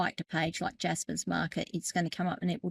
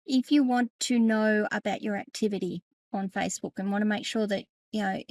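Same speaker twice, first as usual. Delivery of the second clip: ordinary talk, nothing marked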